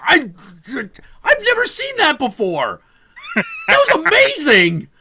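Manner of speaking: high, loud awestruck stammer; high, loud breathless amazement; stunned amazement. Retold or imitated. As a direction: gush in startled wonder. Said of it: "And I'm like".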